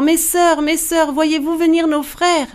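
high-pitched, urgent call; crying out. A narrator's reading. Wife crying toward the ramparts for her sisters, urgent high cry. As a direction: call desperately to someone far up above. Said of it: "Elle cria encore".

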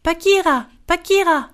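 high call to a passing animal. A narrator's reading. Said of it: "Il l'appela". (appel lancé d'une voix aiguë)

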